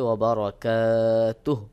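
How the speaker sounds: "ওয়া বারাকাতুহু" stopped as "barakaatuh"; waqf ending. Shown as a demonstration. Teacher shows stopping, "ওয়া বারাকাতুহু" ending on bare h. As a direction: drop the last u of "ওয়া বারাকাতুহু", end on h. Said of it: "যখন আপনি থেমে যাবেন".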